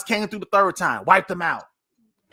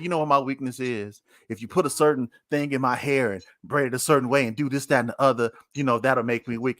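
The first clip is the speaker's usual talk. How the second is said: much lower, deep laid-back teasing; Samson's voice; 10 semitones lower, faster than usual. Retold or imitated. Samson brushing off Delilah, deep lazy tease. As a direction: drop way down and brush her off lazily.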